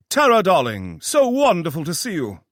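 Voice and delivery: high, loud, flamboyant fake British voice; fake British accent. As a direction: gush it like a hammy posh Brit. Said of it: "His accent was British, only it sounded off".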